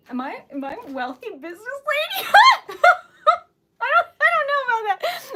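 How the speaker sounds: high, loud laugh-shout; bursting out laughing. Real, not acted. Laughing at being called wealthy.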